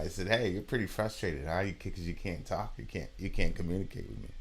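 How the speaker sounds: put-on soft, low talk to a baby; gentle, hushed retold reassurance; soothing. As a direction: retell calmly sympathising with a frustrated infant.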